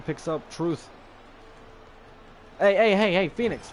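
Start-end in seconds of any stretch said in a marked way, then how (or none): 2.6-3.7 loud, higher burst of heys; real raised-voice interruption; urgent scolding call. Real, not acted. Scolding Phoenix for climbing up.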